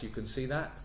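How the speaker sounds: ordinary delivery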